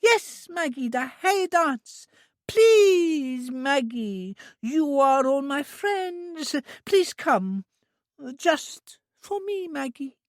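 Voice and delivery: put-on high, slow childlike begging; a child begging a friend to come; high drawn-out childlike please; pleading Trudy. A narrator's reading. Trudy begs Maggie.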